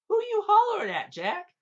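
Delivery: high, loud startled question; baffled demand. A narrator's reading. Giant demanding whom Jack is calling, baffled. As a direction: raise your voice in bewilderment.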